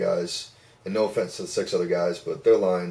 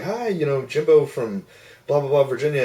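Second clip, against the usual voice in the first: lightly voiced hostess, higher and louder; imitated phone hostess; 6 semitones higher, 4 dB louder than usual. Woman greeting callers off a sheet, imitated phone hostess. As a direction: read out a caller greeting in a raised voice.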